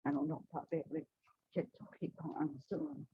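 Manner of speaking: ordinary delivery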